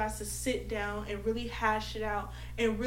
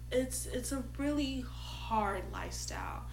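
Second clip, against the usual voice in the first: whispering; 4 dB quieter than usual. Real, not acted. Serious point about polyamory.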